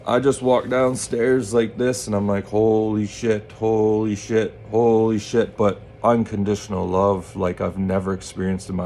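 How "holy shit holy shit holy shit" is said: slow, dazed repeated exclamation; drawn out in disbelief; stunned awe. Retold or imitated. Walking downstairs to his dad, slow dazed awe.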